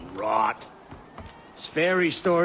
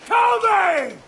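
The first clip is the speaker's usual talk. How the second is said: taunting shout; 12 semitones higher than usual. Acted. Drunk sailor taunting the captain, building to a shout.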